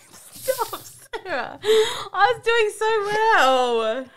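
high, slow, half-crying laugh. Real, not acted. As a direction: beg through helpless laughter. Losing a no-laugh game.

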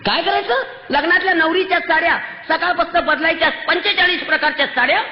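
high-pitched, impassioned tirade; outraged yelling. Real, not acted. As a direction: shout in outrage, pitch held high throughout. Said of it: "ओरडू नको तर काय करू".

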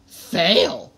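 a loud, croaking Yoda-like rasp; loud puppet croak. A narrator's reading. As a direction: croak the single word out like a little puppet sage. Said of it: "croaked Origami Yoda".

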